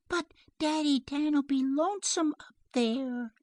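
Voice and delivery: put-on high-pitched child's protest; worried little girl. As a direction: plead for daddy in a small child's voice. Child worries about her father.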